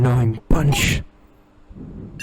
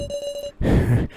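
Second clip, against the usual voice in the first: high sneering cackle; a taunting high laugh; mocking laugh. Captive taunts the group, high mocking cackle. Tied-up stranger mocks the group.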